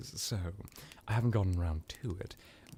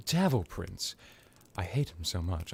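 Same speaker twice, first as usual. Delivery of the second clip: usual speaking voice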